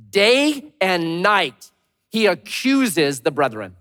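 very slow, weighty quote; slow scripture reading. Shown as a demonstration. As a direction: read the verse with gravity. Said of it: "Revelation twelve ten says this".

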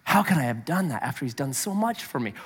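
a quiet, understated hint of a heartbroken penitent; preacher voicing godly sorrow for the congregation; contrite penitent. Shown as a demonstration. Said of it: "Godly sorrow is".

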